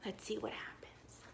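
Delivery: hushed suspense whisper; breathy storyteller whisper. Real, not acted. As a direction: lean in and whisper the secret. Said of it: "whispered suspense to children".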